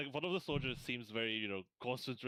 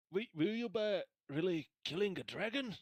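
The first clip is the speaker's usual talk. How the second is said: put-on raised, pitched-up young soldier asking eagerly; npc soldier voice; 8 semitones higher than usual. GM voicing a soldier, asking the party, high and eager.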